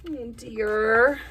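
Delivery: lively storybook read with voiced animals; reading to children. A narrator's reading. Teacher reading a bear story to little kids, animated. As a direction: tell it like a bedtime picture book. Reads picture book aloud.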